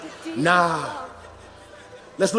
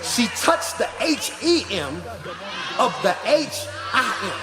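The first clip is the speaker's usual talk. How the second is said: mock whooping preacher; 2 semitones higher than usual. Pastor teasing the congregation, playing the shouting average preacher. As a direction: spell it out like a fired-up preacher bringing the church up. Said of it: "you trying to make me preach".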